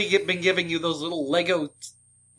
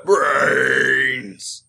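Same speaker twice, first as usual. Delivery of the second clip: zombie voice; 2 semitones lower, 3 dB louder than usual. Acted. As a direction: moan it like a hungry zombie. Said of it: "grunt like a zombie and say "brains".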